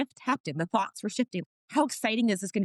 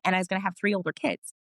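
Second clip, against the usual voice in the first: ordinary talk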